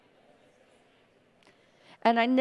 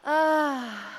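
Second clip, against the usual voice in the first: released sigh-cry; 3 semitones higher, 3 dB louder than usual. Shown as a demonstration. Guide releases anger on the out-breath, loud ah. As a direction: open loud "ah" letting anger out. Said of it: "make some sound".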